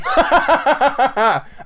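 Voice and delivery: high, loud burst of laughter. Real, not acted. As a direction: burst out in staccato cackling. Laughing at the overruling.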